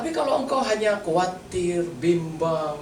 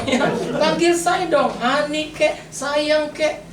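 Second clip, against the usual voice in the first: mock wife pleading; 9 semitones higher, much slower than usual. Wife asking for sweet names.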